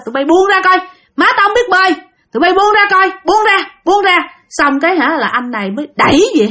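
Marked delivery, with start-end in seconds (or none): 0.1-4.2 desperate shout. Acted. Son shouting at river ghosts holding his mother, frantic. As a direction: yell at them to let her go.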